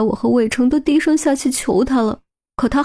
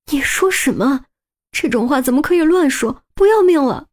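high, rising alarmed rebuke; frightened scolding; 5 semitones higher than usual. Acted. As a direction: scold him, scared for his life. Frightened woman scolds lover.

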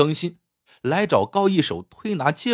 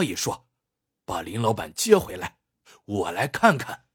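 put-on lower-pitched, determined old man; old man resolved to help; 5 semitones lower than usual. Old man asking his young companion along to save a leg, resolute. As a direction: speak low as a kindly elder taking charge. Voices old Uncle Gao.

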